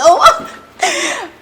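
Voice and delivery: giddy laugh. Real, not acted. Giddy before big announcement.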